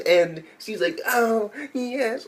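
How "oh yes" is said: high mock girly voice. Quoting female character, high.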